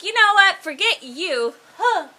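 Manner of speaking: high, loud mock outburst with a huffy finish; hammed-up indignant yell; acted yelling. Krista gets mad at the crew leader, mock angry yell. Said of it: "Krista yells at the leader".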